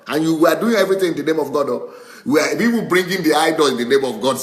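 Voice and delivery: quick fervent preacher's jab; excited preaching. Real, not acted. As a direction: drive the point home with rising heat. Preacher mocking religious hypocrisy.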